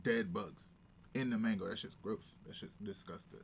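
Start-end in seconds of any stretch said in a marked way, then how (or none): 1.7-3.4 whispered disgust. Real, not acted. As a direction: breathe it out in disgust. Disgust about dead bugs.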